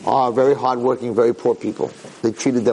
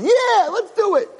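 very high, drawn-out yeah; eager agreement; 3 dB louder, slower than usual. Acted. Father joining his son's cruel plot, eager agreement. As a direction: jump at the idea with excitement.

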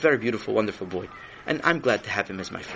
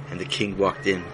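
plain reading voice

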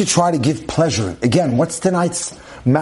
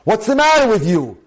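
higher-pitched, irritated demand; acted-out husband picking a fight; angry husband; 6 semitones higher than usual. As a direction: confront your wife with an annoyed complaint. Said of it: "begin their request for help in an angry fashion".

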